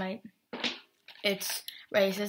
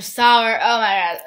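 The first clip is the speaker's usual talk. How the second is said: loud exclaim; 12 dB louder than usual. Real, not acted. Tasting extra sour candy.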